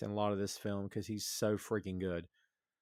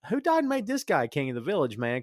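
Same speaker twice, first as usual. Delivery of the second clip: pitched way up, louder, in mock disbelief; indignant mock outrage; 5 semitones higher, 4 dB louder than usual. Mocking the bossy villager, higher and louder. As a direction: scoff at the guy bossing everyone. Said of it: "I'm like".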